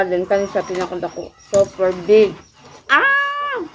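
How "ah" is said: delighted gasp. Real, not acted. Tasting food, delighted reaction.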